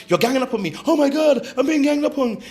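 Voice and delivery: high, loud mock victim; Karen. Mocking someone crying foul when called out, wailing Karen-style. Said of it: "it's a Karen behavior".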